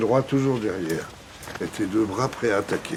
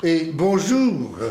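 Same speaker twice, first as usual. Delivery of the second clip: bonjour stretched very slow and louder; warm drawn-out bonjour; 5 semitones higher, 4 dB louder, much slower than usual. Shown as a demonstration. Director models a long hearty bonjour for the trainee. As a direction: sing out a hearty greeting. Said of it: "avec une voix franche et chaleureuse".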